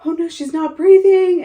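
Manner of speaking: very high, loud, frantic; panicked cry. Retold or imitated. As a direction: retell his terrified cry at full pitch. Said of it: "he's like".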